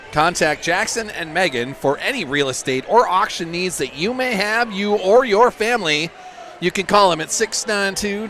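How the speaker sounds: hammed-up sponsor read, pitch lifting as it goes; Jackson Hagerfeld. Commentator reads the realty auction sponsor, impersonating playfully. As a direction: play up the ad as an impersonation. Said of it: "That was my Jackson Hagerfeld impersonation".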